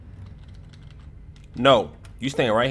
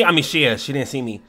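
ordinary talk, nothing marked

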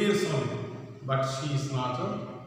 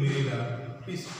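ordinary talk, nothing marked

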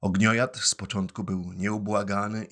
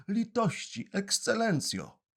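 pleading; 13 semitones higher than usual. Acted. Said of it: "zaczął mówić błagalnym tonem".

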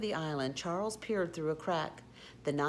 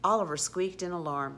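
usual speaking voice

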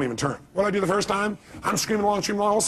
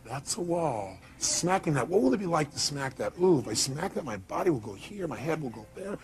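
musing under breath; 7 dB quieter than usual. Retold or imitated. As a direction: mull over the crash to yourself. Mocking overthinking the crash.

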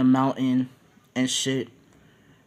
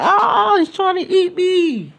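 very high, louder squeaky panic voice; scared little reindeer; 11 dB louder, slower than usual. Retold or imitated. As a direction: squeal it like a tiny frightened critter. Chopper voiced, frightened.

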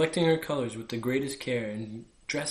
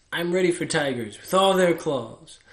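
boastful; 4 semitones higher, 7 dB louder than usual. Acted. Vain flower boasting of thorns.

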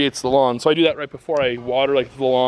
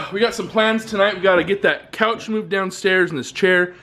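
ordinary delivery

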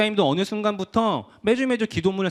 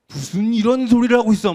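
put-on a sharp, louder mother snapping at her kid; scolding mom; 4 dB louder than usual. Mom hears her kid won't go to church, sharp scolding. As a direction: play the mom telling her child off.

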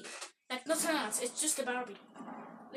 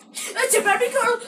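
excited shout; 6 semitones higher, 6 dB louder than usual. Real, not acted.

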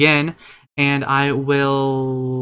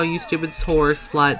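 normal talking voice

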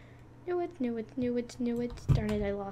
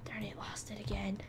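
whispering; 2 semitones lower, 10 dB quieter than usual. Real, not acted. Whispered frustration.

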